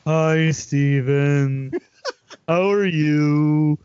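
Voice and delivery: slow, drawn-out awkward greeting; fawning sidekick. Acted. Sidekick greeting the knight he admires, awkwardly slow.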